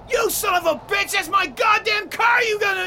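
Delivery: high, loud outraged yelling; furious shout. Acted. Man shouting at his friend over his wrecked car, furious.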